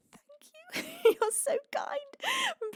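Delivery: high squeaky touched thanks; squealing thanks. Real, not acted. Thanking a chatter for calling her beautiful, high squeal. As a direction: squeal with flattered delight.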